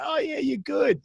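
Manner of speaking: high-pitched mock reassurance; imitating people handing out empty compliments; easy flattery. Retold flattering, insincere praise.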